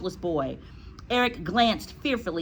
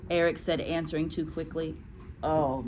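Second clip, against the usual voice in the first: usual speaking voice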